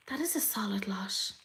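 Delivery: very soft, impressed whisper; hushed, voiceless appreciation; awed whisper. Real, not acted. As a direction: whisper it to yourself in awe. Same words repeated in awed whisper.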